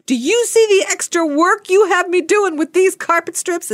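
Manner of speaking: high-pitched, indignant yell; hammed-up high complaint; mock yelling. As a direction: imitate an aggrieved worker yelling. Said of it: "comes in yelling at me".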